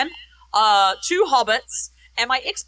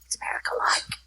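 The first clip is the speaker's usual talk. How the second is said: whispered, curious question; eager whisper; 4 semitones lower, 10 dB quieter than usual. Retold or imitated. Friends back home asking about America, whispering. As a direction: ask in an unvoiced, conspiratorial whisper. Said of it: "everyone was like".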